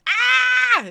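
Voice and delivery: very high, loud scream of surprise; piercing excited shriek; shocked scream. Real, not acted. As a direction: scream at full volume in real shock. Screams at a big donation.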